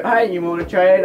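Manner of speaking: ordinary talk, nothing marked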